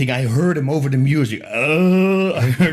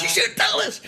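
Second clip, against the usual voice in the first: high mock wife voice through laughter; imitating his wife; 13 semitones higher than usual. Husband mocking his wife's complaint about the story, high, laughing. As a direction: pitch it way up, giggling as the nagging wife. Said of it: "every time she says".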